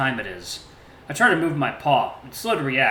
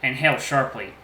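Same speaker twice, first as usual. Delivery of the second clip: ordinary delivery, nothing marked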